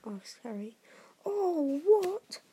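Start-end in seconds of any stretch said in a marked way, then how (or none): 1.2-2.4 high, loud outburst; thundering. A narrator's reading. Hagrid reacting to Harry's ignorance, thundering. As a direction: thunder it in disbelief. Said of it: "Hagrid thundered".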